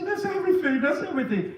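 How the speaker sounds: high-pitched wail easing off; mock crying. Mother answering the preacher's "what else", mock crying. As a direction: imitate a tearful mother finishing her list. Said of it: "So she's crying".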